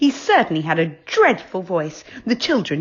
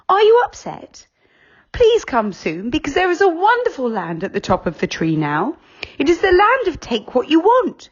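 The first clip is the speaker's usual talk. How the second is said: high, cheerful letter read in voice; Moonface's letter; 11 semitones higher, 4 dB louder than usual. A narrator's reading. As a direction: read the invitation bright and eager. Reading the letter in voice.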